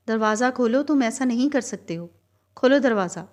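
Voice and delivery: loud, stern demand at a locked door; a trapped woman yells at the man outside to open the door; harsh shout. A narrator's reading. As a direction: shout it hard, banging for him to open up. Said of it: "حد درجہ سخت لہجے میں چیخی".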